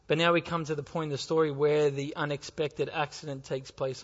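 ordinary delivery

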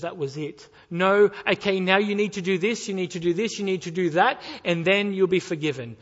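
loud, hammed-up checklist of demands; mock demanding God. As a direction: bark out the rules as a mock stern God. Mock legalistic God's demands.

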